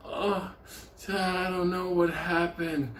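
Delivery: put-on high, slow, bewildered old woman; dazed old lady. Rescued old lady answers the men, high and slow. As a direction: sound lost and shaky-slow, pitched high.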